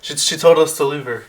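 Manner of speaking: high, loud protest; stumbling, insistent shout; frantic refusal. Acted. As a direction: snap back loudly in panic. GM voices Spring refusing to help.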